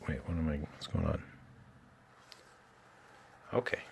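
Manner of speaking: ordinary delivery, nothing marked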